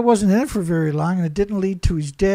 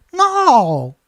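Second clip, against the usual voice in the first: sudden loud high no; emphatic no; 6 semitones higher, 6 dB louder than usual. Real, not acted. Host rejecting starvation theory, loud emphatic no. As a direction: slam the door on the idea. Cutting off his own question.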